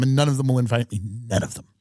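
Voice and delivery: normal talking voice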